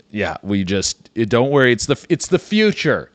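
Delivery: ordinary talk, nothing marked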